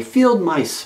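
high, loud call into the wind. A narrator's reading. Bunny calling to mice underground, loud high call. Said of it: "Barrington called".

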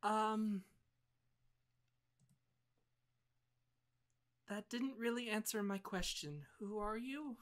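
put-on soft, high-pitched young girl. Wary girl questions a knight through the gate, soft voice. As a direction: raise the pitch and speak gently, like a child. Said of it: "you hear a sort of soft voice".

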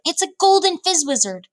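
high-pitched, delighted announcement; thrilled storybook cry; excited exclamation. A narrator's reading. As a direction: exclaim it high over a prize catch. Giant exults over a rare catch.

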